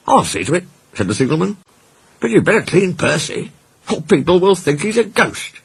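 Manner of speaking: high, bright-eyed signalman greeting the crew; a friendly railwayman, surprised at the sight; cheery signalman. A narrator's reading. Signalman greeting Percy's crew, high and surprised.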